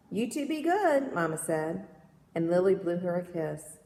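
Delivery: measured, lilting poetry read-aloud; gentle storytelling poem voice. Acted.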